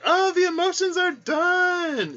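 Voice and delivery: high, bright self-assured quip; mock relief. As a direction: pretend you are over the tears. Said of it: "Every time I'm like".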